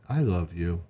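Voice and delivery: low, toneless declaration of love; emotionless. Shown as a demonstration. Wife speaking to her husband, emotionless. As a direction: say it with no feeling at all. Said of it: "in an emotionless voice".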